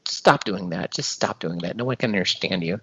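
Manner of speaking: low, soft annoyed scolding; quiet scolding. Retold or imitated. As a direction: hush them quietly, fed up. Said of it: "And Darlene's gonna, like, come at me".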